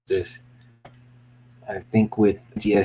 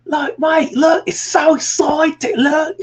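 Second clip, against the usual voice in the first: very high, loud, drawn-out excited squeal; Mike Myers; 5 dB louder, slower than usual. Acted. Creator selling that juice machine, hammed-up excited squeal. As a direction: squeal slowly like a giddy cartoonish character. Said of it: "there's always a Mike Myers ref".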